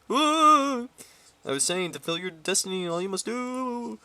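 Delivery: high ghostly phantom voice; overacted death scene. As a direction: ham up a wailing ghost. Phantom delivers message.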